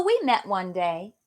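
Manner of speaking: usual speaking voice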